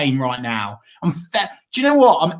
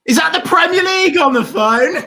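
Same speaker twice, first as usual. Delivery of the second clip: high, loud joking yell into the phone; hammed-up cry down the line as if the league rang; mock phone shout; 10 semitones higher, 5 dB louder, faster than usual. Mock answering a call, crying out.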